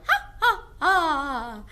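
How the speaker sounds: high, loud cackling witch laugh; witch cackle. Shown as a demonstration. Teacher shows the class a witch's cackle. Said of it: "The first one is witches laugh".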